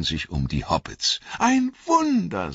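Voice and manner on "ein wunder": much higher, louder, slow elvish marvel; elves in wonder. A narrator's reading. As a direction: marvel aloud, ringing high. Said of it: "sagten sie".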